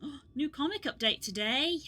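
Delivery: ordinary talk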